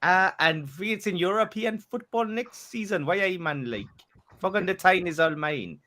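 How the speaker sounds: lightly high mock Geordie accent. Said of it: "it's my Geordie accent".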